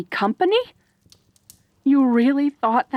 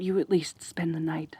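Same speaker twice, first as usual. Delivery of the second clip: soft whispered coaxing; a hushed, unvoiced invitation; 3 semitones lower, 7 dB quieter than usual. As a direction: whisper it gently so they stay. Coaxing the injured guest gently.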